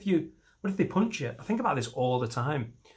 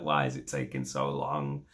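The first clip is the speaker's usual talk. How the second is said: low grumbling complaint; 11 semitones lower than usual. Retold or imitated. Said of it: "You're like".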